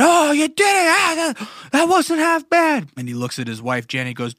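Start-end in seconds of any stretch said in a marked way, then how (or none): 0.0-2.9 excited high-pitched holler in mock New York accent; New York scream. Shepherd praising a first try, screamed in New York accent. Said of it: "screaming with a New, New York accent".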